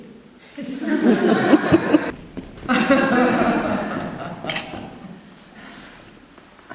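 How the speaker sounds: big breathy burst of laughter; long airy laugh, high and loud; loud real laugh. Real, not acted. Laughs at own old age.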